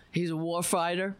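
ordinary delivery

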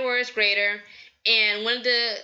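ordinary talk, nothing marked

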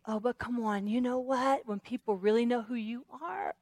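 soft, confiding put-down; understated discouraging enemy voice; insinuating tempter. Acted. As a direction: undermine them quietly.

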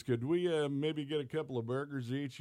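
lightly voiced sheepish ask; timid ask. Dave's timid request, voiced.

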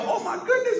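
mock surprise. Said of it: "and I go".

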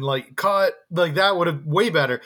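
ordinary delivery

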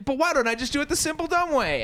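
high-pitched mock naive questioner; infomercial idiot. Infomercial idiot questioning the British pitchman, high naive voice. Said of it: "and then you're like".

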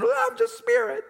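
high, airy mock of a "spirit" type; mock spirit person. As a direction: parody the over-spiritual believer, voice lifted high. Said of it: "just spirit person".

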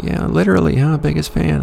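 high, lightly voiced teasing woman; a hint of a sceptical lady.

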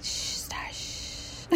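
soft, breathy shush with no voice at all; shushing whisper. Retold or imitated. Speaking to barking dog Star, a hushing whisper. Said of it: "and I whisper".

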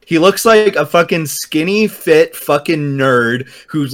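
normal talking voice

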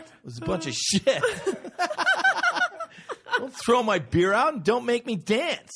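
high, loud laughing rant; comic griping, cracking up; laughing complaint. Real, not acted. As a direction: laugh through the mock outrage. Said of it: "and I was mad".

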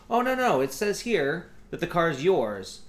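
high, loud imitation of a rep; reassuring phone agent. Car salesman correcting a confused customer, reassuring. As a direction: stretch it out, placating the caller.

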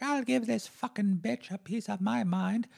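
high put-on voice of a furious mother; angry mom. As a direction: imitate mom ready for a fight. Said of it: "she was like".

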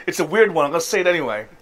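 ordinary talk, nothing marked

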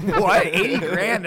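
loud, high disbelief; shocked what, eighty grand; incredulous. Retold or imitated. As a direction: react like you can't believe it. Retold shocked reaction to ex-wife money.

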